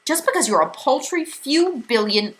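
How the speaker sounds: indignant. A narrator's reading. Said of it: "Missus Watsit started indignantly".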